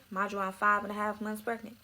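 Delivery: ordinary delivery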